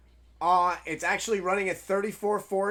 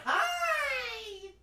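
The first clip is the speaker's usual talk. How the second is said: delighted squeal; 5 dB quieter than usual. Real, not acted. Delighted greeting to pet.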